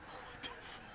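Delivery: soft whispered what the fuck; whispered disbelief. Real, not acted. Gamer whispering shock at the car. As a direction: breathe it out in disbelief.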